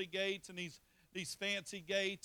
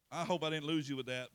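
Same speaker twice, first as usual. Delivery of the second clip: usual speaking voice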